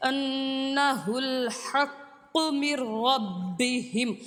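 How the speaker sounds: slow, drawn-out melodic tilawat; slow chanted Quran recitation. Acted.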